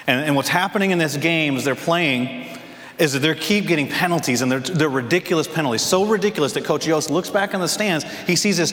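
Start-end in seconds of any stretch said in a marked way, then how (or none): none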